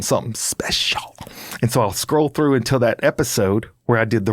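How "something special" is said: slow, teasing whisper; conspiratorial whisper. Retold or imitated. Passenger promising the driver a surprise, slow whisper. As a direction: whisper it like a secret treat.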